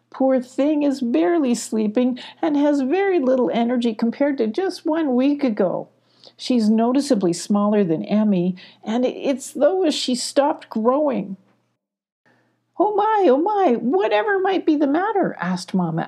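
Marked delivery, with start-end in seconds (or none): 0.1-11.4 higher, anxious pleading voice; worried Shine. Acted. Shine telling Mama Owl about Ella, worried and higher. 12.8-15.3 much higher, fluttery alarmed owl; fretting Mama Owl. Acted. Mama Owl hearing bad news, high and alarmed.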